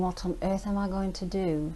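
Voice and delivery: normal talking voice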